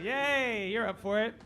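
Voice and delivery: normal talking voice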